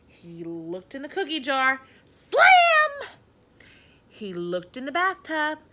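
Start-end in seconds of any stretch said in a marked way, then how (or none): 2.1-3.1 high, loud, punchy slam; sound effect. A narrator's reading. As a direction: slam the word out with force. Sound effect of lid slamming.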